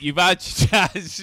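normal talking voice

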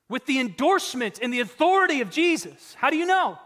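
voicing the congregation's question. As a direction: act out a puzzled listener asking. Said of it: "the big question you probably are asking is".

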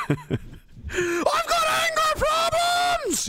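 very high, slow squeaky cry of a puppet brick; brick character voice. Acted. Host voicing Billy the brick, high squeaky wail. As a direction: squeal it like a tiny angry toy.

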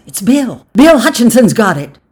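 high, building to loud; excited news. A narrator's reading. Voices name who got it, excited and building. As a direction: spread the news through the crowd.

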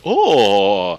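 gaping actor imitation. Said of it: "eyes wide, jaw dropped".

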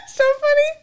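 squealing laugh breaking into words; high laugh. Real, not acted. Laughing at her own drunken story.

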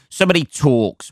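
ordinary talk

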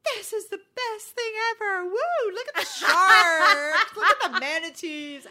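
loud, high, laughing whoops; thrilled shouting over the view; excited. Retold or imitated. Sister in the helicopter, shouting with delight. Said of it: "Cheryl's like".

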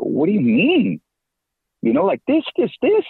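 a retold panic, pitch climbing on each this; panicked protest. Retelling his panic to wife.

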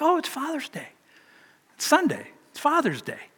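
soft, high startled realisation; the penny drops, quietly; sudden realisation. Retold or imitated. Pastor recalling the moment he remembered Father's Day, surprised.

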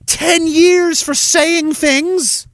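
high, very slow disbelief; incredulous. Pretend. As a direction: stretch it out in shock. Acted naive questioner.

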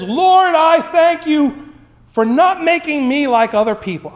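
smug Pharisee prayer. Retold or imitated. The Pharisee thanks God in the temple, preening. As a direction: thank God like a man proud of himself. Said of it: "he raises his eyes to heaven and he says".

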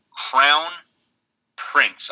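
two words said slowly and apart, long pause between; slow, careful. Real, not acted. Co-host struggling to say two words together, slow and careful. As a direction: pick through the tricky phrase word by word. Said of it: "I have to say it slowly".

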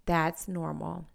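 ordinary talk, nothing marked

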